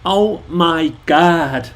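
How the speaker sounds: put-on slow, drawn-out Valley Girl accent. Shown as a demonstration. Showing viewers an American accent, drawled Valley Girl. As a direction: stretch every word like a Valley Girl. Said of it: "we'll just go for Valley Girl".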